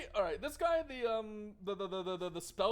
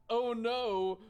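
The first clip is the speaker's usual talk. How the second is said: loud, higher-pitched sarcastic lament; hammed-up horror, breaking into a laugh; mock outrage, sarcastic; 4 semitones higher, 4 dB louder than usual. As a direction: pile on the fake despair over a trifle. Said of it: "I'm being sarcastic, by the way".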